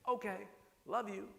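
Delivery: "Okay, love you." lifted high and bright on "love", affectionate dad; cheerful sign-off. Retold or imitated. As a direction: say "Okay, love you." with a light, rising, loving tone. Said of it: "See how angry you are?".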